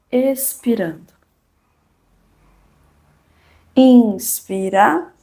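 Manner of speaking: drawn-out, calm yoga instructor cues; slow breathing cues. Acted. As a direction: stretch each cue over the breath.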